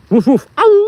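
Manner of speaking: two woofs into a long howl; bark, then howl like a wolf; loud imitated wolf howl. Dog barks into a wolf howl.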